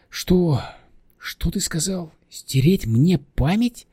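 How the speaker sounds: high-pitched indignant disbelief; offended, drawn-out questioning; mildly angry. Acted. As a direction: bristle at the idea without raising volume. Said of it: "разозлилась на него Нина".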